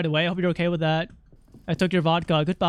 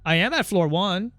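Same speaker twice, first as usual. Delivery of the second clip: ordinary delivery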